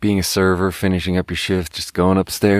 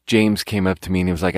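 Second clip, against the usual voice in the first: ordinary talk, nothing marked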